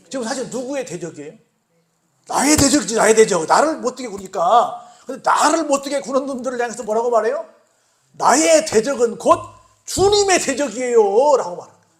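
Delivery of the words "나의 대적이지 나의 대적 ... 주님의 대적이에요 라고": loud, higher preaching, slowing at the close; fiery preaching. Acted. Preacher driving home the enemy point, loud and fervent. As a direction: preach it hard to the congregation.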